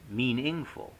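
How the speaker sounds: "meaningful" slowly, stressed on ING; wrong middle stress. Shown as a demonstration. Lecturer showing students what not to say, mean-ING-ful. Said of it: "no stress on the second syllable".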